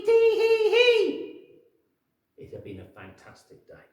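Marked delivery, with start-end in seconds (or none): 0.0-1.3 very high, loud, slow tee-hee giggle; monkey delighted with his trick; cheeky monkey giggle. Acted.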